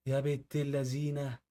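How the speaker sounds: slow, drawn-out marvel at the trick; slow amazed exclamation. Real, not acted. Reacting to a tape hack, slow impressed exclamation. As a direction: savour it, impressed and teasing.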